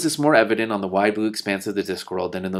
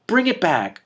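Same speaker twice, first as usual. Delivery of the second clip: high, loud call at the sky; shouting; 10 semitones higher, 5 dB louder than usual. Acted. Hrun shouting upward for his silver coin, loud. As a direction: yell up after the lost coin.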